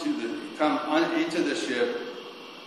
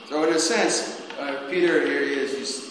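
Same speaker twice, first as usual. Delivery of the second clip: ordinary delivery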